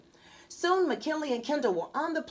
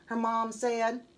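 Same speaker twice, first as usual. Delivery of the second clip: usual speaking voice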